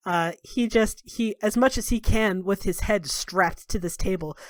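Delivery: ordinary talk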